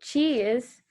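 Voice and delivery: ordinary talk, nothing marked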